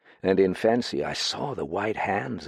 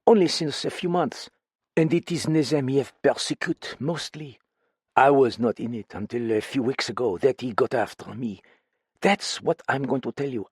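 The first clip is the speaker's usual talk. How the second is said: put-on higher, foreign-accented woman, fretful; frightened foreign woman confiding in a friend; lightly French-tinged voice, uneasy and pleading; accented woman character; 5 semitones higher than usual. Same character voice.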